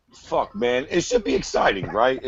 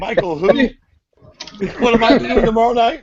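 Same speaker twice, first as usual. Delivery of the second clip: much higher and louder, cocky and oblivious; mock Luke boast; 10 semitones higher, 6 dB louder than usual. Podcaster imitating the fighter dismissing his opponent, mock cocky. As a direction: brush the fight off like a partying playboy.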